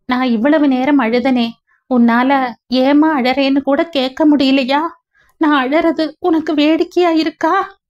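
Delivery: tearful sniffling. A narrator's reading. Niece reproaching her aunt while sniffling and crying. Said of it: "முசு முசுவென்று அழும் குரலில்".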